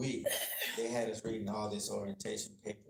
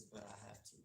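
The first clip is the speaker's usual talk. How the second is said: softly tearful judge; 17 dB quieter than usual. Retold or imitated. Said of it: "she started crying".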